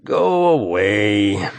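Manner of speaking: slow, weary groan of a reply; tired voice. A narrator's reading. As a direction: drag it out, exhausted. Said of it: "A tired voice answers".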